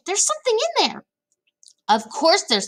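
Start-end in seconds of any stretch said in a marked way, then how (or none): 0.1-1.1 very high, excited squeal without extra volume; high, excited little girl; crying out. A narrator's reading. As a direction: squeal it in amazed discovery. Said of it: "cried out".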